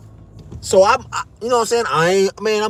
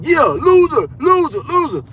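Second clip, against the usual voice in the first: higher, louder taunting chant; mocking taunt; 8 semitones higher, 3 dB louder, slower than usual. Guard taunting him with her hand in his face, higher jeering. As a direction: jeer like a schoolyard bully.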